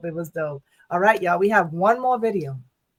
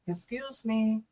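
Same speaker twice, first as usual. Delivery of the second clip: man heard afar, polite; 9 dB quieter, slower than usual. Retold or imitated. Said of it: "Like, his accent, he was like".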